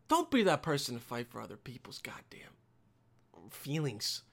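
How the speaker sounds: hushed, confiding whisper. Real, not acted. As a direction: lean in and whisper the advice. Dropping to a whisper for the advice.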